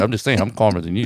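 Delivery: low, quick, understated comeback; insisting he's calm. Retold or imitated. Veteran in the car insisting on calm, low and quick. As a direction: rattle it off low, playing unbothered.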